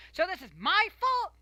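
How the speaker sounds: very high, loud mocking yell; retelling the mother's outraged yell. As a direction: yell it back like the indignant mom. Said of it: "she yelled".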